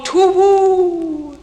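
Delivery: high, ringing owl call, loud and long; owl's song. A narrator's reading. Reader gives the staring owl's refrain, high singing hoot. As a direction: hoot it high, stretching each woo. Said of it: "then nightly sings the staring owl".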